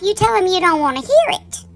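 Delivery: normal talking voice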